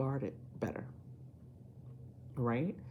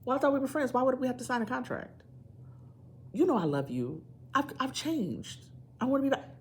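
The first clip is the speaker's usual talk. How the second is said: higher, wheedling guilt-trip; manipulative ex; 8 semitones higher than usual. Pretend. Ex pressuring the viewer to skip a contract, wheedling. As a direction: play the hurt ex, coaxing. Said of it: "If you get things like".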